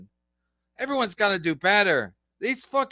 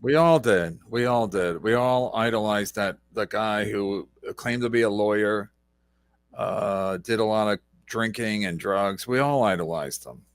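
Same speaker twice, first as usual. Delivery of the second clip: sarcastic low voice; 10 semitones lower than usual. Pretend. Sarcastic aside.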